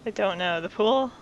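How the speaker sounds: ordinary delivery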